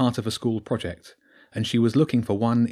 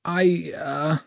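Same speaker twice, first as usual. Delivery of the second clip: a halting, nervous stammer; a frightened girl caught off guard, stumbling over words; mild hesitant stutter; 7 semitones higher than usual. Acted. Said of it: "Jenny stuttered".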